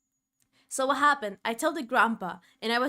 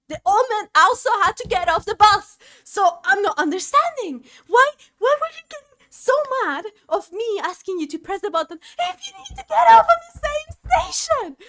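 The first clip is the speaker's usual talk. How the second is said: much higher and louder, indignant; furious incredulous rant; outraged disbelief; 11 semitones higher, 11 dB louder than usual. Real, not acted. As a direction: shout it in exasperated disbelief. Outraged punchline about the old man.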